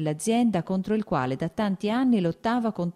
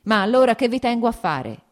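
loud, higher angry shout; furious outburst at the steward; 6 semitones higher, 7 dB louder than usual. A narrator's reading. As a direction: yell it at the useless steward. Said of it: "gridò".

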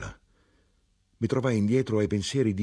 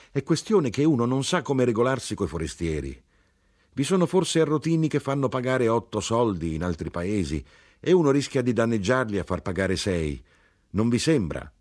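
higher-pitched, earnest self-justifying character; lifted voice, pleading his case; earnest knife grinder; 6 semitones higher, 4 dB louder than usual. A narrator's reading. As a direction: explain yourself earnestly, voice lifted. Voices the knife grinder explaining himself.

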